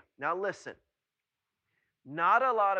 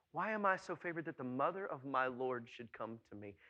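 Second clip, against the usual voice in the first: softer, lower, awed repeat; hushed wonder; 3 semitones lower, 6 dB quieter than usual. Acted. Preacher repeating Elizabeth's words for listeners to hear, hushed wonder. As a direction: bring it down to quiet amazement. Repeats verse softly after exclaiming it.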